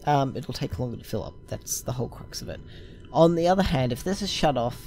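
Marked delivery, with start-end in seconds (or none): 1.5-2.6 whispered. Real, not acted. Aside while explaining the layout.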